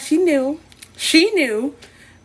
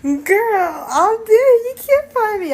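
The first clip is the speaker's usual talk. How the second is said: mortified squeal; 11 semitones higher than usual. Real, not acted. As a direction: squeal like you're dying of embarrassment.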